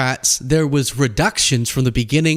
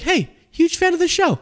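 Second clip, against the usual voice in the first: friendly fan; 14 semitones higher than usual. Retold or imitated. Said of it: "Delta employee was like".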